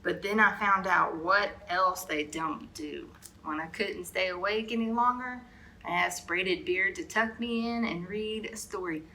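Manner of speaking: plain reading voice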